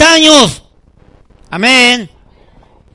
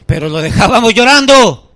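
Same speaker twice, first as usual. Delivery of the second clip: ordinary talk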